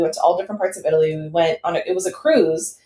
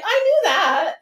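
embarrassed squeak; 11 semitones higher, slower than usual. Retold or imitated. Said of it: "I was like".

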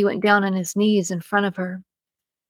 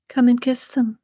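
seductive whisper; 4 semitones higher than usual. Acted. Jane seduces Randy.

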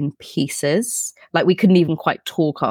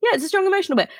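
mock echo; 12 semitones higher, much faster than usual. Mocking Jamie's words back.

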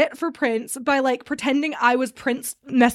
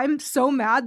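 ordinary talk, nothing marked

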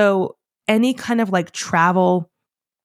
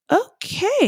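drawn-out, high "okay"; intrigued okay; 13 semitones higher than usual. Retold or imitated. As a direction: stretch it out high, as if warming to an idea. Acts out intrigued reaction to tour.

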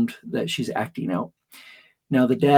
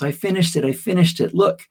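high, proud calling out; excited child; 6 semitones higher than usual. Retold or imitated. Girl runs in to show parents her picture, high and excited. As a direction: show off the drawing like a thrilled kid. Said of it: "comes over and yells".

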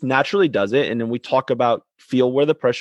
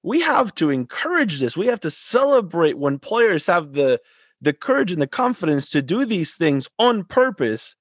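much higher, fervent retold rant; impassioned argument; 6 semitones higher than usual. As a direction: preach it to the referee. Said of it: "I was like".

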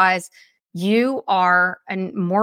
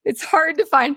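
choking up through tears; snotty crying; 7 semitones higher than usual. Real, not acted. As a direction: voice breaking high, crying. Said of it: "I know, ugly cry".

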